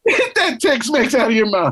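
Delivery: cracking up while echoing the joke; laughing repeat. Real, not acted. Repeating the cohost's Tex-Mex line, laughing.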